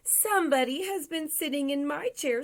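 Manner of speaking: put-on high-pitched Baby Bear; small, high storybook bear voice; wee little voice. A narrator's reading. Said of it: "in his wee little voice".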